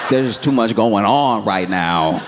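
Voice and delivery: high, backpedaling protest that settles slightly; mock flustered excuse. Caught texter explains away the traffic lie, mock flustered. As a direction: scramble to cover a caught lie.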